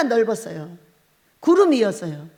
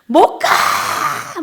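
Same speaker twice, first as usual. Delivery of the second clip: wailing cry; 3 semitones higher, 4 dB louder, much slower than usual. Retold or imitated. Refusing to leave heaven, a long wailing cry. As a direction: stretch the refusal into a wail. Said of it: "내 울음소리".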